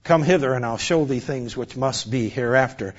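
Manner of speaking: soft solemn revelation; hushed divine voice. Acted. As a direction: speak like a voice from heaven. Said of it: "he heard a voice say".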